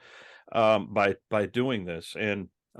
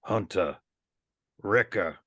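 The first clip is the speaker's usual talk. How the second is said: lightly voiced Morrison-style New Zealand accent, names said low; names read low in a New Zealand accent; Temuera Morrison's New Zealand accent; 3 semitones lower than usual. Podcast host contrasts Morrison with Omega, low New Zealand accent. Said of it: "if you had Tamora Morrison saying".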